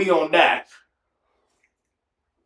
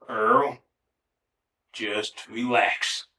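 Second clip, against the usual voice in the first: slow, soft, lower self-talk; self-calming; 7 semitones lower, 8 dB quieter, much slower than usual. Retold or imitated. Earl calming himself in deep water, slow and hushed.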